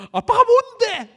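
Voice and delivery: very high, loud, slow screamed retort; defiant daughter yelling at her father; defiant shout. Acted. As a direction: scream back at dad.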